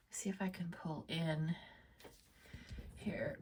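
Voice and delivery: soft whisper, trailing away; whispered to herself. Real, not acted. Artist whispering to herself while inking. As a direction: breathe it out quietly as you concentrate.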